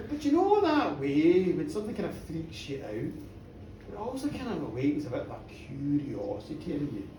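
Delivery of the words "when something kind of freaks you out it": usual speaking voice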